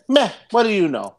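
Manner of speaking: high, dismissive New York scoff; mock New Yorker brushing someone off; New York accent. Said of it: "You sound so New York".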